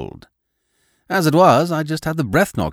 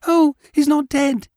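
put-on high little boy voice, a bit louder; a child relieved and excited; eager small boy; 4 dB louder than usual. A narrator's reading. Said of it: "said the small boy".

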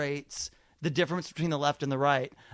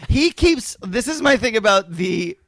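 ordinary talk

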